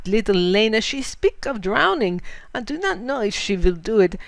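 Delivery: put-on a frightened old German mail driver pleading; German accent. Fritz tells of Lena's danger, in a German accent.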